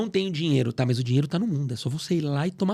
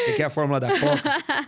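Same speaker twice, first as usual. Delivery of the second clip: high, laughing repeat of the tease; playful laughing tease; 10 semitones higher than usual. Real, not acted. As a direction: say it again through a laugh. Repeats teasing question laughing.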